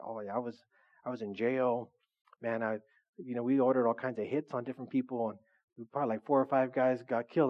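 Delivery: low, quiet mock bragging; casual tough-guy testimony. Man at a retreat testifying about jail, mock casual low voice. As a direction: imitate a man casually recounting crimes, voice dropped. Retells man's boastful prison testimony.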